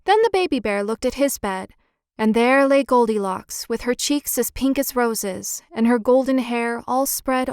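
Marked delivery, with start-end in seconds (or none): none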